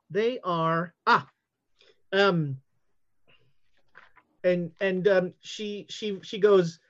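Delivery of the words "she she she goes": usual speaking voice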